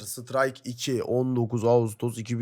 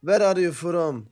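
mock foreign tourist; 6 semitones higher, 6 dB louder, slower than usual. Switch to English role.

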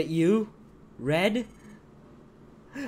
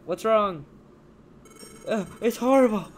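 distressed voice; 6 semitones higher, 4 dB louder than usual. Acted. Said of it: "Voice cracks".

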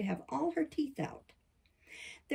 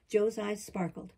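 plain reading voice

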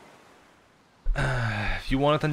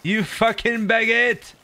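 drawn-out mock yell; 7 semitones higher, 3 dB louder, slower than usual. Repeated insult, drawn out.

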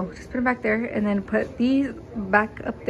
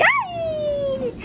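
much higher squeal of joy; delighted cheer. Real, not acted. Mom opens the closet door to her baby, joyful squeal.

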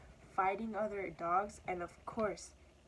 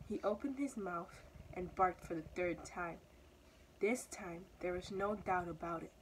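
ordinary delivery, nothing marked

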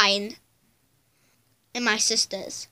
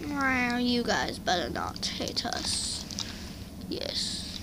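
hushed threatening whisper; 3 semitones lower, 11 dB quieter than usual. Pretend. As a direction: breathe it as a warning. Doll threatens, then agrees.

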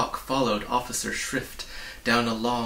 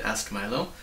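ordinary delivery

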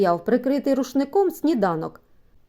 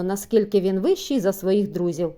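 ordinary delivery, nothing marked

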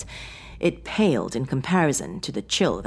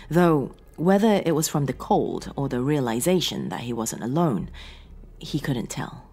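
ordinary delivery

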